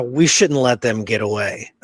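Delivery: ordinary delivery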